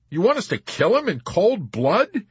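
high, loud, incredulous question; objecting. A narrator's reading. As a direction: question it in appalled protest. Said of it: "Yossarian objected".

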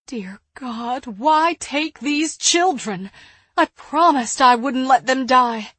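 starts restrained, builds to a loud cry; anguished plea. A narrator's reading. Woman pleading to God for children, building anguish. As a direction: plead with rising pitch and volume.

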